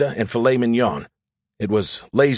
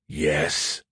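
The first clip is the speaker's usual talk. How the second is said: lower, softer, disgusted reply; 7 semitones lower, 5 dB quieter than usual. Acted. AI admitting he understands, low and disgusted. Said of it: "in a low, disgusted voice".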